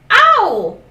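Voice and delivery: loud, high yelp of pain; pained cry. Acted. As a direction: cry out as if the wing burns. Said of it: "said the dragon".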